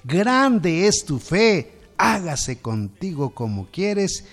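high, loud, slow marveling cry; amazed exclamation. A narrator's reading. Jesus marveling at the woman's faith, slow loud exclamation. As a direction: draw out Oh mujer in wonder.

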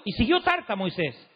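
ordinary delivery

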